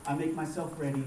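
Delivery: low, soft prayer in short phrases; model prayer. Acted. Pastor offering listeners words to pray to God, quietly prayerful. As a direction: lead them gently through a personal prayer. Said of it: "pray for yourself".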